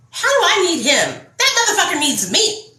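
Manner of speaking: high, exclaimed disbelief; indignant outburst. Acted. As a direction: snap out of pleading into scornful outrage. Indignant turn on her own pleading.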